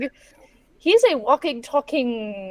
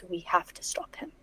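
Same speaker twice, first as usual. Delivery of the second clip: whispering; 5 semitones lower, 9 dB quieter than usual. Real, not acted. Whispered planning.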